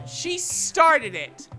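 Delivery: loud, high, drawn-out accusation; angry blaming. Acted. Natsuki blaming Yuri to Hayate, angry. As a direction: lean hard on each word.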